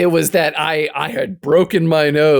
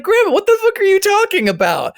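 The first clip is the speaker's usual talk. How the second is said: high, baffled outburst; incredulous yell. Retold or imitated. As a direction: react in total disbelief. Retelling own reaction.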